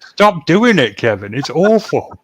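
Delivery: loud, high-pitched plea to stop; exasperated protest. Real, not acted. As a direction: cry out in comic exasperation. Protesting at co-host's bad accent.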